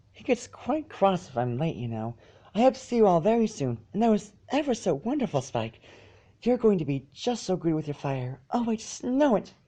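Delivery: high, soft, sweet encouraging pony; gentle Fluttershy. A narrator's reading. Fluttershy says goodbye and praises Spike, high and soft. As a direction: coo it softly and brightly.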